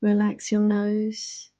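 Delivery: ordinary delivery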